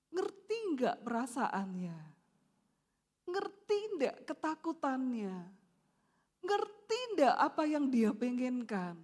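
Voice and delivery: ordinary talk, nothing marked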